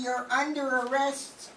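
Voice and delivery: loud, stern arrest declaration; stern accusation. Acted. Sergeant arrests the hostess, loud and stern. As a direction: pronounce the charge with force.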